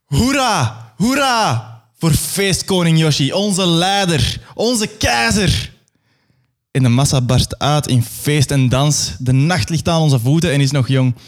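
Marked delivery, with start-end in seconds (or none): 0.1-5.7 high, slowed jubilant crowd chant; cheering crowd. Acted. The masses hail feestkoning Yoshi, high, slow chant. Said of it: "klinkt het".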